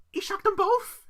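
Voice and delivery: high, loud exclamation; outraged disbelief. Real, not acted. Host telling co-host of the affair, outraged exclamation. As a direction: blurt it out, incredulous.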